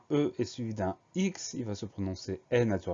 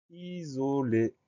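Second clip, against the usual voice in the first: slow, drawn-out syllables, slightly higher pitch; slow dictation; 4 semitones higher, much slower than usual. Shown as a demonstration. Teacher announces the next spelling word, slow dictation. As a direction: stretch out each syllable for dictation.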